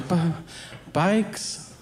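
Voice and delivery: mock-timid, rising at the end; timid kid. Scared kid admits what he stole, timid mock answer.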